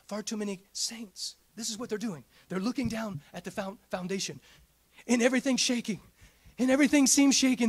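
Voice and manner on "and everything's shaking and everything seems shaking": louder and faster with each phrase; rising sermon crescendo; building preaching. Acted. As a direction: drive the shaking home. Pastor rising in intensity.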